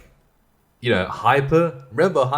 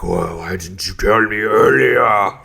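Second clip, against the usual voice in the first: slow, put-on indignant buyer voice; mock complaining buyer; 4 dB louder, slower than usual. Quoting a buyer who complains about the sale, slow and whiny. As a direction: play the buyer whining slowly.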